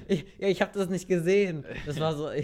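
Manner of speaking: talking through laughter; laughing. Real, not acted. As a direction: laugh while admitting it. Laughing at own confusion.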